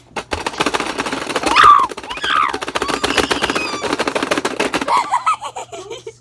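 long high laughing fit; shrieking laugh. Real, not acted. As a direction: laugh till you scream.

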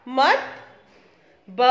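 ordinary delivery

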